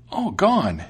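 high, loud startled mouse; exclaiming mouse. A narrator's reading. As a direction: cry the name back in astonishment. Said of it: "exclaimed the mouse".

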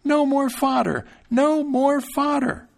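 high, slow, drawn-out shout; tiny voice crying from the cow's stomach; frightened loud cry. A narrator's reading. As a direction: yell it in fright from inside the cow. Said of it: "cried out as loud as he could".

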